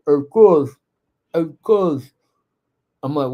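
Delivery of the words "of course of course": mimicking a cousin whose lips have swelled up, words mushy and thick; swollen-lip voice. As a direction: say it as if your lips are puffed up from an allergy. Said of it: "Why are you talking like that?".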